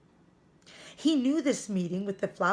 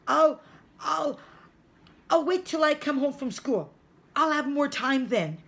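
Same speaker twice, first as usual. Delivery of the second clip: high, loud, tripping over "I'll"; flustered stammer; 8 semitones higher, 6 dB louder than usual. Acted. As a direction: rush out an excuse, stammering. Joachim stalls Mama in panic.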